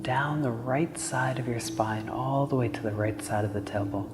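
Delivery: calm, unhurried meditation voice with long pauses; guided meditation. Acted. Yoga teacher leading listeners through Nadi Shona breathing, calm guided meditation. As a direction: gently guide them through each breath, letting phrases settle.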